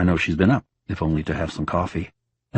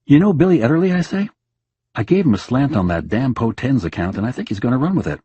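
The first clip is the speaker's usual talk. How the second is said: bright husband chatter; 6 semitones higher, 4 dB louder than usual. Acted. Husband chatting to dead wife.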